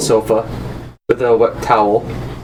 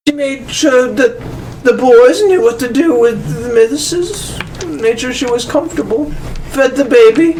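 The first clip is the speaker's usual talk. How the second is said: high, breathy lady's voice; breathy vintage purr; 8 semitones higher than usual. Acted. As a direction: gossip like a grand dame. GM continues Lady Jezebel.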